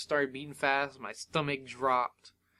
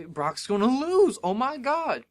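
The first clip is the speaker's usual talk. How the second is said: higher, breaking into amazed excitement; retold excited disbelief; 9 semitones higher, 5 dB louder than usual. Fan watching a near upset, excited. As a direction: re-enact a stunned fan's outburst. Re-enacts thinking the favorite will lose.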